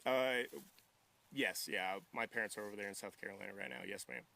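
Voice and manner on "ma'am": ordinary talk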